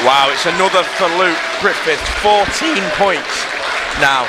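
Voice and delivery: high, loud excited call; excited shout. Real, not acted. Commentator excited over point.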